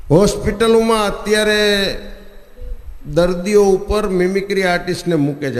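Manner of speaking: ordinary talk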